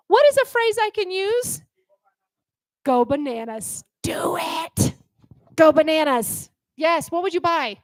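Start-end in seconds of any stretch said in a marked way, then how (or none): 4.0-4.9 whispered urging. Acted. Teacher urges students to go bananas, whispered. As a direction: breathe it as a secret dare.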